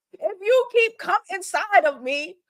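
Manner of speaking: mock girlfriend scolding in broken English; imitating her broken English. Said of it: "in her broken English".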